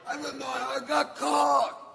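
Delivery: high-pitched comic shout. Acted. Shouted escalation.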